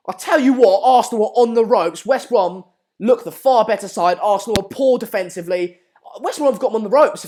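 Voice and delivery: worked-up match reaction; fired-up commentary. Real, not acted. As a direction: hammer the point home, still buzzing from the chance. Fired up after a near miss.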